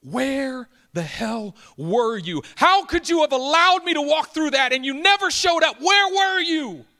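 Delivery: drawn-out cry building to a loud, high scream; anguished scream. Retold or imitated. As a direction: re-enact a wailing accusation, erupting at full volume. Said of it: "I screamed at the chair".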